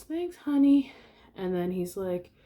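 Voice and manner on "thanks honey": high, loud imitation of a mom. Mom thanking her son after the photo, high motherly voice. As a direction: chirp it high as a mother would. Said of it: "his mom goes".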